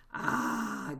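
long breathy "ah" after a drink; loud gasp. A narrator's reading. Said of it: "gasped the smallest fox".

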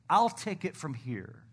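ordinary talk

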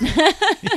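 high burst of laughter. Real, not acted. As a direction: laugh out loud. Laughing at joke.

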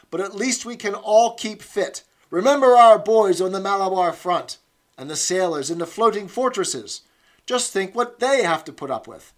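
loud, high, rousing encouragement; encouraging. Acted. Instructress urging the comrades to keep fit, loud and encouraging. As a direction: pump up the squad to keep fit. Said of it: "she added encouragingly".

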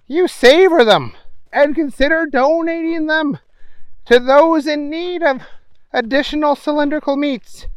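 high bright newscaster voice; news reader. A narrator's reading. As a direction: read it like a TV anchor. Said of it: "The newswoman continued".

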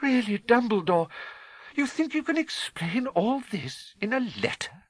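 high, exasperated protest; McGonagall, incredulous. A narrator's reading.

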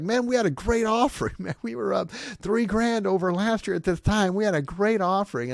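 hammed-up pastor bragging about the offering; excited pastor. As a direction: crow over the money like a proud pastor. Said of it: "Another pastor's like".

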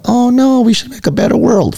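mock the helpless masses in a high whine; mock whiny humans. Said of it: "we're all like".

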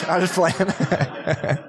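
slow words through a laugh. Real, not acted. Pastor laughing off his video joke to the congregation. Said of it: "No, I'm just playing".

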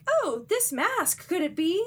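hammed-up dawning realization; mock wonder. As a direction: marvel at a find in a high voice. Masuko discovers the mask.

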